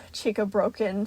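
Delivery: usual speaking voice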